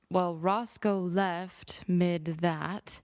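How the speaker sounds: usual speaking voice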